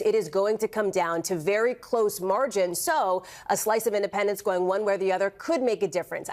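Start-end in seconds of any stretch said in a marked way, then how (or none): 2.8-6.3 a reporter weighing her words, stressing could. Real, not acted. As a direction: give the word could a clear extra push. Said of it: "I emphasize the word could".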